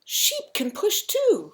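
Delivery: high, loud, drawn-out character voice; excited suggestion. A narrator's reading. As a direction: announce the bright idea slowly and loudly, pitched high. Frog's excited idea in story.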